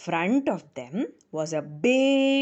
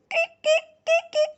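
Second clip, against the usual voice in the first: very high sharp kik; kingfisher call; faster than usual. Pretend. Storyteller calls like a baby kingfisher, very high. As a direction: squeak it like a tiny bird. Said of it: "Their voices also sounded different".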